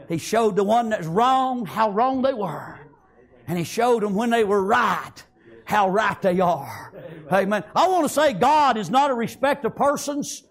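usual speaking voice

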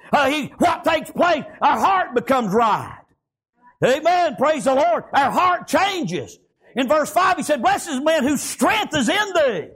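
full-voiced, chanted sermon delivery; fervent preaching. Real, not acted. Preacher urging congregation to draw strength from God, fervent preaching.

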